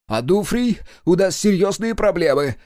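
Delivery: high, loud panicked blurt. Acted. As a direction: burst out in a rush of alarm. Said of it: "выпалил он".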